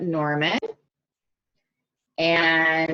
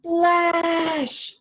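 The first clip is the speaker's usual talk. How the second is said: much higher, excited splash; splashy sound word; 12 semitones higher than usual. Acted. Teacher reading to kids, acting the splash with high excitement. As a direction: fling the word out like a big splash. Pig jumps into the puddle.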